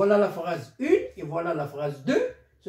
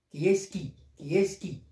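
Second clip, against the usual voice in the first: ordinary talk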